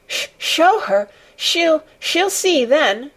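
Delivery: high, slow tearful stammer; sobbing boy's broken high cry; gasping between sobs. A narrator's reading. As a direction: force the words out between sobs. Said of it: "he gasped out between two sobs".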